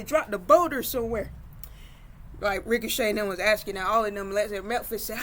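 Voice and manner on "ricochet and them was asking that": ordinary delivery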